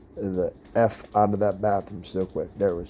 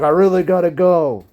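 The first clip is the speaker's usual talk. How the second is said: high, loud, urgent; a man outside the stall, desperate to go; desperate plea; 9 semitones higher, 7 dB louder than usual. Retold or imitated. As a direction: plead like you're about to burst.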